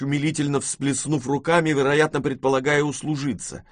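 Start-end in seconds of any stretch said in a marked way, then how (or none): none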